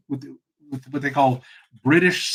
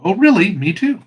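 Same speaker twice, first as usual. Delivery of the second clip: mildly hammed-up happy surprise, louder; mock delighted agreement; 5 semitones higher, 8 dB louder than usual. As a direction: play pleased to find common ground. Joke's delighted common-ground reply, escalating.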